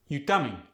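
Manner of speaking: "coming" falls at the end; Khmer-style question, "coming" going down; no rising tone. Shown as a demonstration. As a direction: drop the pitch on "coming" despite the question. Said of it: "No rising tone".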